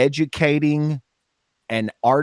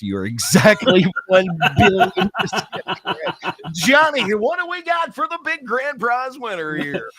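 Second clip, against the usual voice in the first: game show host; 9 semitones higher, 4 dB louder than usual. Pretend.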